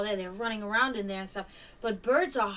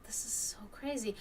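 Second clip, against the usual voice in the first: hushed amazement under the breath; whispered disbelief; 10 dB quieter than usual. Retold or imitated. Hawk moves closer, an amazed whisper.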